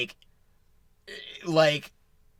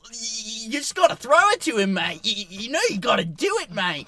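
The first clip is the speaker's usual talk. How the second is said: put-on Danny Morrison New Zealand accent; 10 semitones higher, 5 dB louder than usual. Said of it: "Such a good accent".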